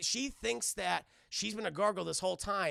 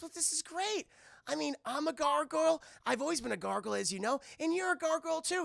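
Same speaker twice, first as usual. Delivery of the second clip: high, cheerfully certain imitation; Elisa Maza; 8 semitones higher than usual. Elisa telling Goliath he's a gargoyle, acted delight. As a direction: insist brightly in a pitched-up voice. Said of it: "She goes".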